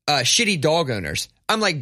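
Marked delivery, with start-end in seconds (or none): none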